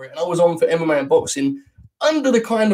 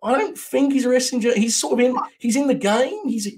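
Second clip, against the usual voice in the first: petering-out host plug; 6 semitones higher than usual. Retold or imitated. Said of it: "and then it sort of petered down".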